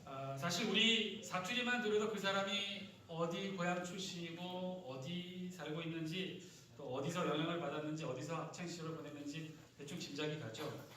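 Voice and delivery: ordinary talk, nothing marked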